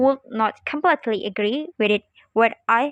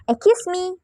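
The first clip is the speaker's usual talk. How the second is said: higher-pitched "Excuse me" to get noticed; attracting attention; 7 semitones higher, 3 dB louder than usual. Shown as a demonstration. A wishing to attract B's attention, attention-getting. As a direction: call for someone's attention with "Excuse me". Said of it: "A, wishing to attractives, attracts B's attention".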